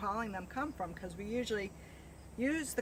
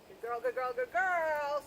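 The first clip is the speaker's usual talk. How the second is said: squeaky praising a dog; 7 semitones higher than usual. Real, not acted.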